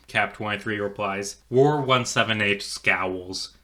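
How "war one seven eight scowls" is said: plain reading voice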